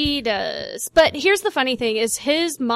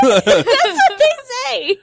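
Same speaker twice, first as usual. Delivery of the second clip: laughing; 10 semitones higher, 6 dB louder, slower than usual. Real, not acted. Laughing reply about Cajun phrasing.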